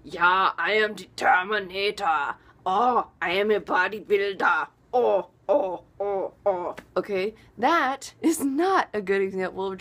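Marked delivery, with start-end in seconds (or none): 0.0-6.8 loud, hammed-up Austrian bodybuilder; mock Austrian action-star impression with grunts; Arnold Schwarzenegger. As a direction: bellow like a cartoon Austrian strongman. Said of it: "Arnold Schwarzenegger".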